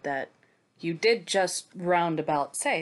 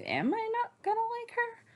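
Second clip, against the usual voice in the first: high, anxious little question, much higher; worried self-quote; 4 dB quieter than usual. Retold or imitated. Going into the Sansa chapters, asking herself worriedly in a high voice. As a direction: squeak it out like a nervous first-time reader. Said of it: "So I was kinda going in like".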